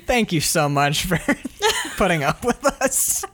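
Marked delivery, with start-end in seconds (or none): 1.6-3.2 high giggle through the thanks; laughing. Real, not acted. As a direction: laugh while you thank them. Laughs while thanking patrons.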